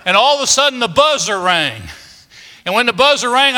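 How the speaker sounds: ordinary delivery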